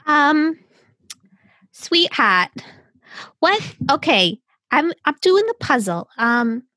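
be a puzzled mom stuck on the clue; playing a mom. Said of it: "Do you like a mom?".

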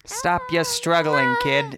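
put-on loud, slow New York tough-guy accent; hammed-up New York gangster bullying a captive. Gangster to kidnapped Samantha, hammed-up New York accent. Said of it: "Is that a New York accent?".

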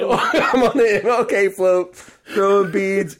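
loud, high, slow crowing; retold triumphant whoop; laughing gloat. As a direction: stretch out the absurd boast, laughing. Gloats on the MLK float.

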